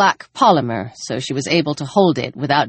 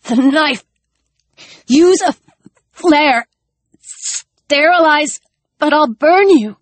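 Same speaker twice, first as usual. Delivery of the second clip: high, gasping, breaking words; broken airy gasps; 9 semitones higher, 3 dB louder than usual. Acted. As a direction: force each word out between gasps. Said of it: "his words broke with airy gasps".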